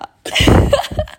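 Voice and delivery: hohes, hauchiges Kichern; hohes Lachen. Real, not acted. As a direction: lach hell und luftig los. Lacht nach Neckerei. (hohes, gehauchtes Lachen)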